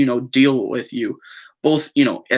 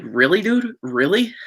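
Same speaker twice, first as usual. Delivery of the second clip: higher-pitched "really, dude", incredulous; exasperated disbelief; 6 semitones higher than usual. Retold or imitated. Reacting to friends who lied to him, with raised, incredulous disbelief. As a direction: throw up your hands at the friend, in a mock of disbelief.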